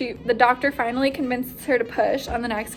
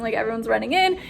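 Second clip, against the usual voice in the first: usual speaking voice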